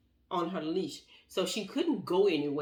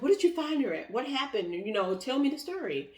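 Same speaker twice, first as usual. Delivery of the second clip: excited questions; 8 semitones higher than usual. Retold or imitated. Eager questions to husband.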